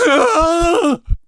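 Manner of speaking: high, screaming burst of mad laughter; maniacal laugh. Acted. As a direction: cackle like a madman losing control. Madman bursts out at the murder.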